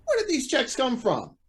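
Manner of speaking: high, falling, bewildered question; alarmed demand. Acted. Judge confronted with incriminating checks, alarmed.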